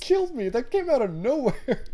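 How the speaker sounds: high-pitched, delighted exclamation; tickled outburst. Real, not acted. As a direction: squeal it, bowled over by how funny it was. Delighted reaction to the zombie number.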